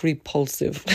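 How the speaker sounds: normal talking voice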